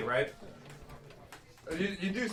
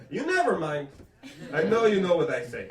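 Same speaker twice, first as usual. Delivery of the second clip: put-on dismissive mother with French Canadian accent; 3 semitones lower than usual. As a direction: shut down the argument in French Canadian English. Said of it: "That's my mother's favorite thing to say".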